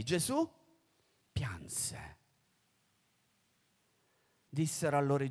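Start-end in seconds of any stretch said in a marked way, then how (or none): 1.3-2.2 appena sussurrato, più basso. A narrator's reading. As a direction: lascia cadere la parola in un sussurro. Gesù pianse, momento solenne. (Gesù piange, la parola sussurrata)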